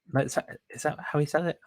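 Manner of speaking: ordinary talk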